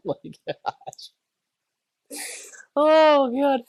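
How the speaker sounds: breathless laugh into high cry. Real, not acted. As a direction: laugh it out helplessly. Laughing at booty joke.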